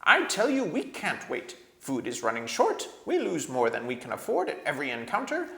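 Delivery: angry dwarf. A narrator's reading. Nickabrick snapping at the king, high and furious. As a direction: spit it out with bitter rage.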